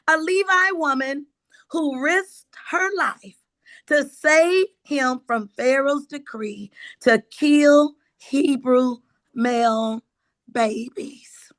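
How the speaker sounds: ordinary talk, nothing marked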